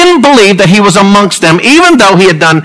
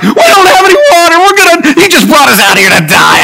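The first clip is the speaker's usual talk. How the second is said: higher, whiny complaint, hammed up; mock whining; 8 semitones higher than usual. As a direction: whine like a spoiled crowd. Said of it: "a bunch of whiners".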